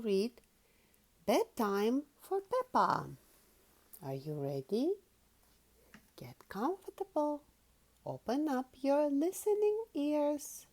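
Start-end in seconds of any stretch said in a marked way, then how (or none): 6.2-7.4 soft whisper. Acted. Settling children in for story.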